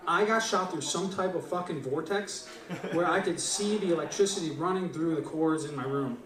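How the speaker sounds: ordinary delivery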